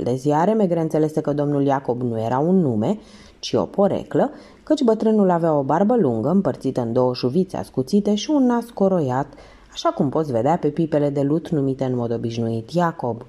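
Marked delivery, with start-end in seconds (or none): none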